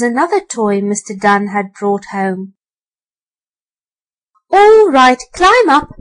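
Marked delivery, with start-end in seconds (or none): 4.5-5.9 loud, high calling out; called out. A narrator's reading. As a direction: call it across the attic. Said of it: "called out the rat".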